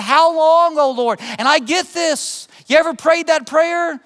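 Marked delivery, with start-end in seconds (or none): 0.0-1.2 higher-pitched cry of appeal. Acted. Suffering Christians to God, crying out. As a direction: plead upward, voice lifted. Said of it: "they're crying out to God".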